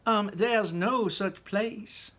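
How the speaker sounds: high, slow doubting lady voice; Missus Salt objecting. A narrator's reading. Missus Salt contradicting Wonka, slow high doubtful voice. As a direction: draw it out, high and sceptical. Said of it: "said Missus Salt".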